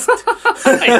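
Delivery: loud, high laugh; real burst of laughter. Real, not acted. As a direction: crack up at a cheesy pickup line. Laughs at the pickup line.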